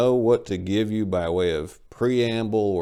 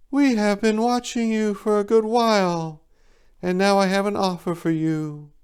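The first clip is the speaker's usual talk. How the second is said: calm bird voice; 10 semitones higher than usual. A narrator's reading. Golden bird offering the dying narrator life, calmly. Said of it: "she calmly stated".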